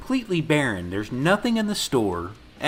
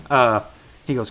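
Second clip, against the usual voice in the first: ordinary delivery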